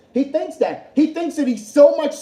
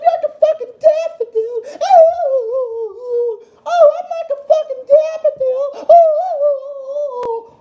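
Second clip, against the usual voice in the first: very high laughing falsetto; mock falsetto; 4 dB louder, slower than usual. Streamer mocking Gilbert as a daffodil, giggling high falsetto. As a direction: squeal it out like a dainty flower.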